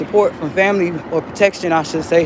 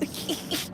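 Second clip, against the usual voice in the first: short breathy laugh; 10 semitones higher, 14 dB quieter than usual. Real, not acted. Laugh sound alone on line.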